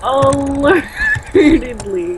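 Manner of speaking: Alertedly stretched very slowly, high; slow mocking repetition of Alertedly; drawn-out disbelief. Shown as a demonstration. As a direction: savour Alertedly syllable by syllable. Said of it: "alertedly is not a word".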